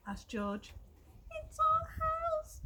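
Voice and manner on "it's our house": very high, slow squeak of a mother mouse; squeaky mouse. Acted. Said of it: "squeaked the mother mouse".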